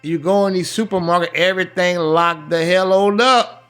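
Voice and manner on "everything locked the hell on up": normal talking voice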